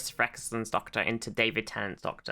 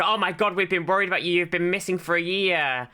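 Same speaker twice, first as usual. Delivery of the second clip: mock worried family; 6 semitones higher than usual. Imitating the family greeting Rose back. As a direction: play them fussing and frantic. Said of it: "and they're like".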